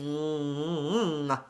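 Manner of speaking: soft hummed mm with a loose jaw; soft humming. Shown as a demonstration. Coach hums to show the nasal ng resonance. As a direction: hum quietly, jaw unhinged. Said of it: "Notice how I'm unhinged in the jaw".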